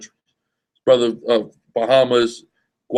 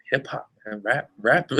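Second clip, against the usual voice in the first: softer, lower mock Vietnamese accent; 2 semitones lower, 6 dB quieter than usual. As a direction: imitate the Vietnamese-raised man lightly. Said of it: "He's talking with a Vietnamese accent".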